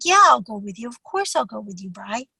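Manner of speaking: a tender yes to a husband asking for help; gentle reassurance. Retold or imitated. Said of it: "I was like".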